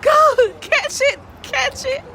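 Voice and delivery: high loud go, catch it; playful high cheering; mock urging shout. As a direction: cheer a late passenger on. Cheering on runner to catch ship.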